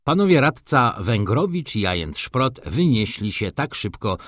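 ordinary delivery

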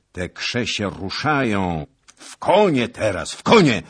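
building to a loud, high order; urgent command. A narrator's reading. Knight to comrades spotting the enemy, urgent rising shout.

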